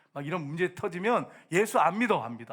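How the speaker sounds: normal talking voice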